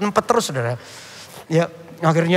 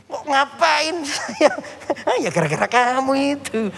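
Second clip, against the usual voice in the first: high, slow, laughing through the words; laughing retort; 7 semitones higher, slower than usual. Retold or imitated. Pastor laughing back at the boy, high and slow. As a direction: laugh out the answer in disbelief.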